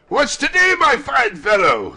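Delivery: high, loud, jovial shout; hearty calling. A narrator's reading. Scrooge to the boy below, calling heartily. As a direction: holler a jolly question downward.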